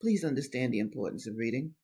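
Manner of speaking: low, soft, quick appeal; hushed plea. Real, not acted. Reader pleading with listeners after her outburst, hushed. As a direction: drop right down and plead.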